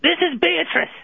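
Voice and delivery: put-on a middle-aged Londoner's British accent, a bit brash. British-accented ex ringing the radio show to scold Tommy. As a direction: play a cheeky London ex-girlfriend in British accent.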